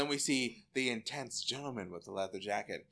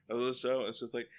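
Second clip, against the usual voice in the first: ordinary talk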